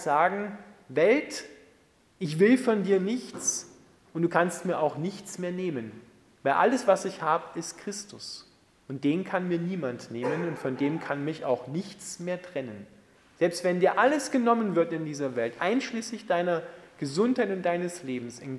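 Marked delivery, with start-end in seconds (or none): none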